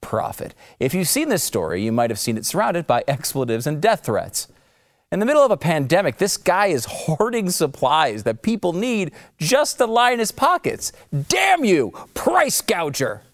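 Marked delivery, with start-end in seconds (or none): 5.1-13.2 rising hammed-up fury, much higher by the end; a building fake rant, slowed on the final insult; mock outrage. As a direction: shake a fist at the villain.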